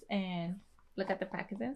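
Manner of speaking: ordinary delivery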